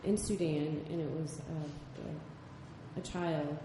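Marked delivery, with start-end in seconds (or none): none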